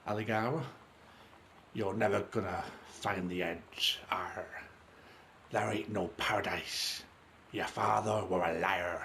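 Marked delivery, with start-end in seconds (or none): 1.7-9.0 slow, gruff pirate growl with an arr; pirate impression. Pirate captain yelling at Captain Aligara, hammed-up pirate voice. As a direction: ham up a pirate captain taunting his enemy. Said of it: "That's my great pirate impression".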